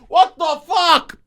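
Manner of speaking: high, loud, drawn-out mock shout; mock furious yell. Driver acting out yelling between beeps, mock furious shout. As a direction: yell it slowly like an outraged driver. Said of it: "I'll be like".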